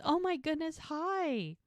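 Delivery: high, surprised squeal of joy; gasping delight. Retold or imitated. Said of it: "she gasps, she goes".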